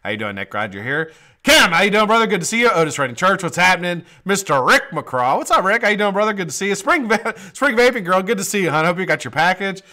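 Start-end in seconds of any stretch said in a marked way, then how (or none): 1.4-4.0 bright loud shout-out to chat, pitched up; excited greeting. Real, not acted. Host hyping up viewers joining live chat, excited. 4.2-6.7 bright loud shout-out to chat, pitched up; excited greeting. Real, not acted. Host hyping up viewers joining live chat, excited.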